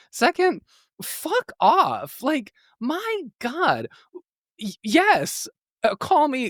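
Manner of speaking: high-pitched, incredulous indignation; outraged retort. Retold or imitated. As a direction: fire back at the insult, voice way up high. Acted outraged retort to an insult.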